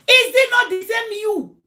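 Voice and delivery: high, loud, drawn-out challenge; acted accusation. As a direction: confront her, stretching the words. Acts out accusing the friend.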